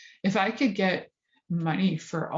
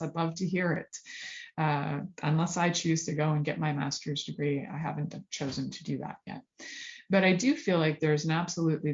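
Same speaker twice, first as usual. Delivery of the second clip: ordinary delivery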